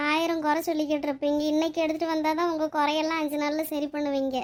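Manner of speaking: usual speaking voice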